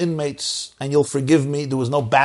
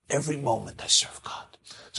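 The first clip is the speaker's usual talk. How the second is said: breathy preacher's whisper; 10 dB quieter than usual. Real, not acted. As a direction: drop to a hush for the listeners. Sermon drops to a whisper.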